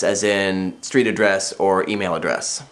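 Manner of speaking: usual speaking voice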